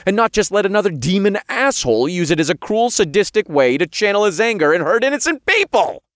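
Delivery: fast, high, loud tirade; angry outburst. A narrator's reading. Hero defends taking the demon throne, angry. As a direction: build to an indignant peak.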